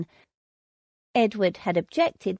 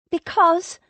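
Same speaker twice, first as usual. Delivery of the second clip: anxious, higher and louder; hesitant, higher repeat; 9 semitones higher, 4 dB louder than usual. A narrator's reading. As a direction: force the word out again, nervous. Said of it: "she closed her eyes".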